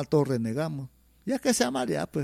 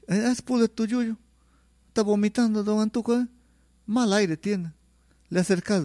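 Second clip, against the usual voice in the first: put-on old folk saying; 6 semitones higher than usual. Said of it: "pues decimos antiguamente".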